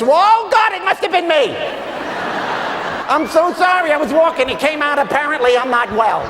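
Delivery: high-pitched, frantic hammed-up apology; guilty neurotic dog. Guilty dog confessing to owner about a mess, high mock panic. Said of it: "the attitude of a dog".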